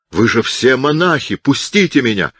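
a mild narrated cry for release. A narrator's reading. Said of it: "крикнул Аццо более громким голосом".

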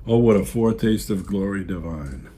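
ordinary talk, nothing marked

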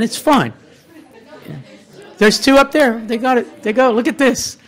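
high, insistent, informal urging; calling people in. Real, not acted. Host urges latecomers to take seats, calling them in. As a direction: wave latecomers in with eager insistence.

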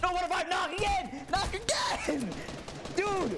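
high, shouted game callout; urgent callout. Real, not acted. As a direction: yell to a teammate mid-fight. Calling out a low enemy.

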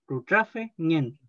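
Rutrafe ñen drawn out slowly, part by part; slow model pronunciation. Shown as a demonstration. Teacher models Rutrafe ñen for students, slow and clear. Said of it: "el tema de la pron".

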